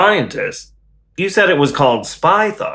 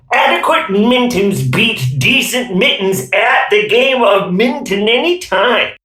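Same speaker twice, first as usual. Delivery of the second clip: ordinary talk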